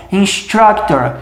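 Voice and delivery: Instructor drawn out slowly, stress on struc; slow model word. Shown as a demonstration. Teacher repeating Instructor slowly for students. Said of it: "repare que a tônica está aqui".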